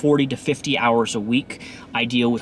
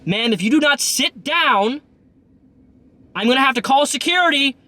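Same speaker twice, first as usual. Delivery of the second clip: retold yell; 11 semitones higher than usual. Bus driver warning a drunk passenger to sit. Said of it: "I yelled this".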